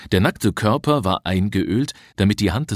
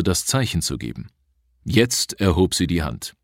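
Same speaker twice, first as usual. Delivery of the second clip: plain reading voice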